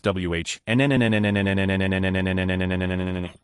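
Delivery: one long drawn-out sloth "nnn"; slow sloth groan. Acted. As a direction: drag the sloth noise out forever. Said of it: "Taking literally as long as possible is crucial".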